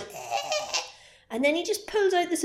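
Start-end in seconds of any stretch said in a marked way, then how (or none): 0.0-0.8 high choked squeak; a victim choking in the air; strangled gasp. Retold or imitated.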